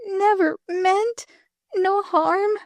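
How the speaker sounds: high, weak, slowing words; dying girl. Acted. Dying girl confesses she only wanted fun. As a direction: confess it with the last strength.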